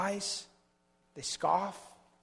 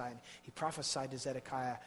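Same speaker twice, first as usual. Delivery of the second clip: normal talking voice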